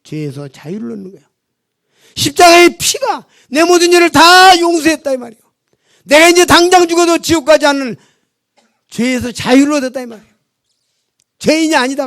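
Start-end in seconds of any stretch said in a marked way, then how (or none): 2.1-12.1 loud, high preaching at full force; loud preaching to the congregation; forceful preaching. Acted. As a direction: proclaim it to the congregation.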